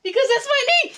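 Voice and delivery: very high, loud puppet voice; Ernie. Acted. Puppet answers his own riddle, in excited character voice. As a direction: burst out with the punchline.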